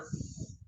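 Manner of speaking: "s" as a voiceless hiss; isolated, soft "s" sound. Shown as a demonstration. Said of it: "The S here makes a sound".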